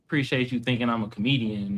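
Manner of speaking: ordinary talk, nothing marked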